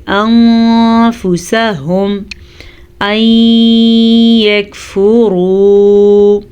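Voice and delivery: slow, drawn-out tajweed chant; Quran recitation. Shown as a demonstration. Teacher reciting for students. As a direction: intone the Arabic slowly.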